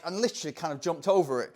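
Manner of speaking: normal talking voice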